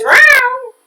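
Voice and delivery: high, quick mock call of a willing woman; mock flirty call. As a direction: toss it off high like a mocking imitation. Mocking woman who says yes.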